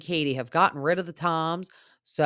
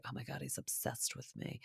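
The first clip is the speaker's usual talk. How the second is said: breathy gushing mockery of Scheana, much softer; much softer mocking impression of a smitten Scheana; 18 dB quieter than usual. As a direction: play her as dreamy and self-impressed. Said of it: "and she's like".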